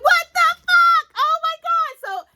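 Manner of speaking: high, loud, drawn-out outburst of disbelief; stunned high-pitched cry at a plot twist; shocked reaction. Retold or imitated. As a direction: exclaim it slowly, way up high in shock. Retelling her shock at the twist.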